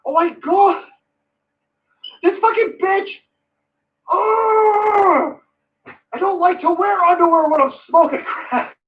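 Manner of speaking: high, wailing mock complaint; whiny son to dad. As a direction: wail it like a spoiled son tattling to dad. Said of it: "Could you imagine saying to your dad".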